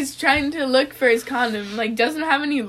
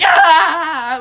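loud, higher mock scream; imitated scream; 4 semitones higher, 9 dB louder than usual. Friend retelling a lover freaking out, mock scream. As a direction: cry out like a sudden cramp hit. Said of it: "Like, starts screaming".

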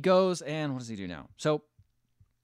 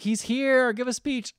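high, louder mock announcement; crowd calling out; 11 semitones higher, 4 dB louder than usual. The crowd urges Jet to give a speech, calling out high. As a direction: imitate an expectant crowd calling up front.

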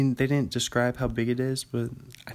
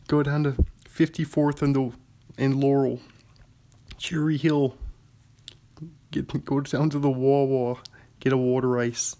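put-on Philadelphia accent. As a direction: play a Philly local giving directions, Philadelphia accent. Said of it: "They do the Philly".

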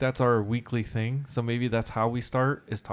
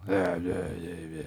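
mock superhero growl; Batman; 4 semitones lower than usual. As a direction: drop into a throaty superhero voice. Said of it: "having the Batman voice".

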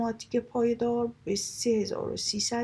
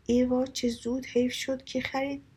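higher, louder cry of disappointment; dismayed surprise; 5 semitones higher, 4 dB louder than usual. Acted. A fashionable lady learning the chairs were sold, dismayed. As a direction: gasp over the lost bargain.

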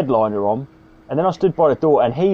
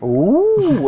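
mock ooh; 10 semitones higher than usual. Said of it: "and I went".

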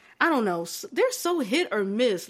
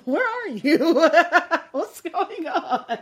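slow, laughing mock panic, higher; 7 semitones higher, 4 dB louder, much slower than usual. To her friend, retelling her fright with a laughing voice. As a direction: replay the scared question through giggles. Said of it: "I got anxious. I was like".

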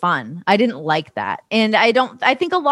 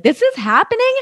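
amused disbelief; 13 semitones higher than usual. Retold or imitated. Acting out comic disbelief.

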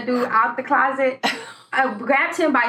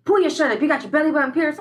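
higher, demanding mom voice; a hint of a stern mother; imitating stern mom; 5 semitones higher, faster than usual. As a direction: order it like a suspicious mom. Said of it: "she says".

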